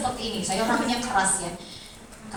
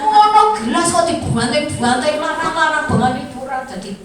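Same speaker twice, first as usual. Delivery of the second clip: mock scolding parent; 3 semitones higher, 5 dB louder than usual. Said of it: "Nah, di sini".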